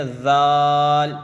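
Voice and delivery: ذال held very long, sung loud; teacher chanting ذال slowly; long soft letter. Shown as a demonstration. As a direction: stretch ذال three alif lengths, lightly. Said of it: "تین الف کے برابر".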